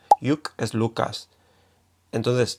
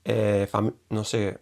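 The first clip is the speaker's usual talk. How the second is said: ordinary talk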